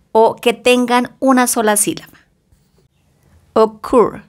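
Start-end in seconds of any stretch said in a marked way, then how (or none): 3.5-4.2 Occur with the stress on the final syllable, -cur; stress on last syllable. Shown as a demonstration. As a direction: lean on the second syllable of Occur. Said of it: "acento en la última sílaba".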